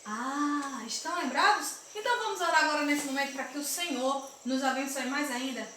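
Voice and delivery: ordinary delivery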